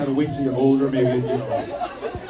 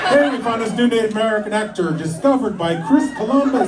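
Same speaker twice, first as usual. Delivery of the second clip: 1 mock announcer hype; 10 semitones higher, 4 dB louder than usual. Imagined news hype about discovery.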